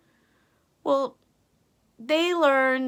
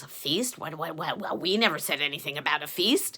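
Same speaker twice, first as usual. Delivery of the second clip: worried villagers; 6 semitones lower than usual. A narrator's reading. Townspeople whisper among themselves.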